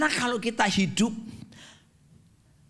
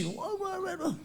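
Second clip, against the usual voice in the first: mock awe; 11 semitones higher, 9 dB quieter than usual. Mocking flowery prayer.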